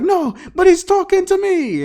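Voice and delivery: high, loud mock protest; mad. Owner insisting to his partner the dog talks, mad. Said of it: "He gets mad and be like".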